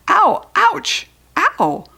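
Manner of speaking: pained yelps. Acted. Lady's feet swept.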